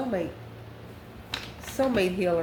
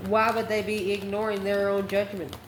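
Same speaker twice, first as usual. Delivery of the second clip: ordinary talk, nothing marked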